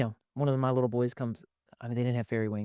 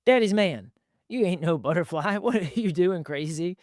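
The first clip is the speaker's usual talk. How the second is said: dad teasing his son, higher and laughing; playful dad; 6 semitones higher than usual. Retold or imitated. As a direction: tease with a laugh. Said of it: "dad voice in story".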